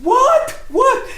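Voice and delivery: high, loud startled "What? What?"; baffled shout. Retold or imitated. Husband on a chair yells at his laughing wife, baffled. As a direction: yelp it out in total confusion.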